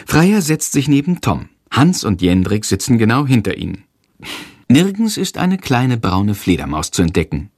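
ordinary delivery

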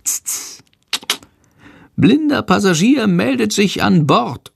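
high bat voice announcing himself; proud bat. A narrator's reading. Little bat Sándor proudly announcing himself aboard the plane. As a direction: announce yourself like a stowaway.

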